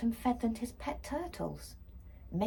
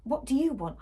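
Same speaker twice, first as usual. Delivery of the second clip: higher, louder irritated question; annoyed; 6 semitones higher, 6 dB louder than usual. A narrator's reading. Girl finding brother in her room, annoyed. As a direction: snap at the intruding brother. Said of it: "I asked".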